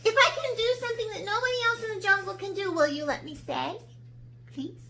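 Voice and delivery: quick, high eager bargaining; girl talking fast to save herself; hurried plea. A narrator's reading. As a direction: rush the offer out. Sarah's voice.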